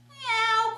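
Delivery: high, loud mournful cat cry; miserable meow. Acted. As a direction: wail a sad meow. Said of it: "cried Max miserably".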